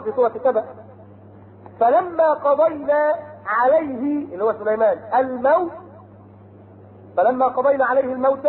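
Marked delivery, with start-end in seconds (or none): 1.8-4.2 slow melodic chant of the verse; Quran recitation. Acted. Preacher to his congregation, chanting a Quran verse slowly. 5.1-8.5 melodic chanted verse; Quran recitation. Acted. Preacher reciting the verse on Solomon's death, chanted.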